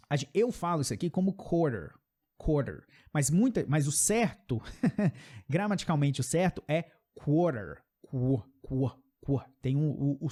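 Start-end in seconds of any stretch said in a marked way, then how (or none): none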